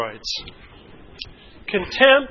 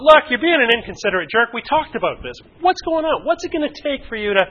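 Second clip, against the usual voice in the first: higher, exasperated scolding; angry criticism; 5 semitones higher, 5 dB louder than usual. Acted. Partner on twelfth late arrival, angry scolding. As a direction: lay into them with rising exasperation.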